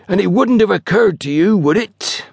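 high, pompous sarcasm; sarcastic. A narrator's reading. Station master reproaches his porter, heavily sarcastic. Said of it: "the weight of his sarcasm".

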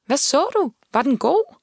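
high, lilting questions called after her. A narrator's reading. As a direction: call after her with rising pitch. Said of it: "råbte han". (råbte spørgsmål i lyst toneleje)

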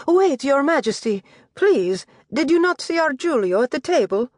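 high, urgent plea to the king; pleading mistress. Acted. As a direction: stop him, anxious and high.

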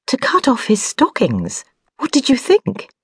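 high, impatient snap; sharp tone. A narrator's reading. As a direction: cut him down for the silly question. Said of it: "the sharp tone that has appeared".

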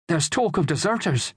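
put-on high-pitched boy's voice; young boy chipping in. A narrator's reading. Son chipping in on army talk, piping boyish voice. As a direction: pipe up as a keen lad. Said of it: "Daniel chipped in".

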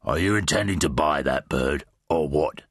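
low, gruff shopkeeper. Acted. Flabsweat confronting Twig over the bird, low gruff voice. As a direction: challenge him in a low gruff voice. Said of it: "came a gruff voice".

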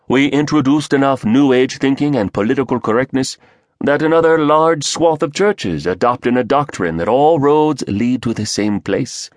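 sing-song Indian speaker; Indian accent. Acted. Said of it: "a lilting Indian voice added".

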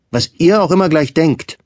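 angry outburst. A narrator's reading. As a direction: fling it back at them in anger. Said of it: "rief der Junge zornig". (der Junge ruft zornig zu den Erwachsenen, viel höher)

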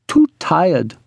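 high-pitched sulky complaint. A narrator's reading. Ruby refusing coffee from the bed, sulky. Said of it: "suddenly sulky from the bed".

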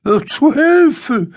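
high, loud call for help; narrated donkey's alarmed, high-pitched yell; distraught cry. A narrator's reading. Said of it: "ruft der Esel ganz verstört".

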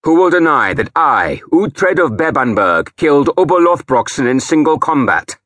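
loud, high challenge to the crowd; shouting. A narrator's reading. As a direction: shout it across the chapel. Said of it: "I shouted".

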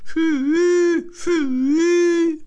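very high loud shouted hü hü; locomotive call. A narrator's reading. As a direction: whistle call like a steam engine.

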